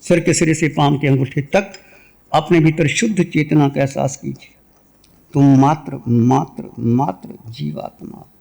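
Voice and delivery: low, calm meditation voice with long pauses; guided meditation. Real, not acted. Teacher leading listeners into meditation, calm and low. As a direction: guide them inward in low, unhurried phrases.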